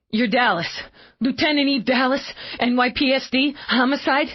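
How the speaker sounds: high, breathless panicked man. Acted. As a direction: blurt it out in panic.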